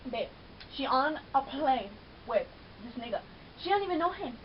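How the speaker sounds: normal talking voice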